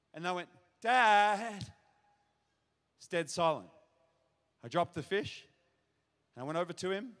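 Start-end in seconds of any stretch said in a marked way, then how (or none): 0.8-1.6 calling again. Retold or imitated. No answer, calls again.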